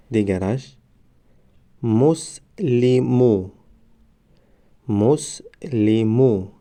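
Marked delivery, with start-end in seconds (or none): 1.8-6.5 مسلمو stretched very slowly, syllable by syllable; drawn-out demo. Shown as a demonstration. Teacher modelling the word for students, very slow. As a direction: draw out مسلمو slowly for the class. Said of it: "ما باید همان حرف را فقط آوازش را تلفظ کنیم".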